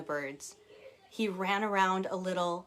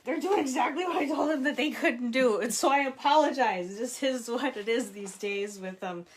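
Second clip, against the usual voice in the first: laughing exasperation; 5 semitones higher, 5 dB louder than usual. Real, not acted. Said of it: "The mom in the back of my mind knew".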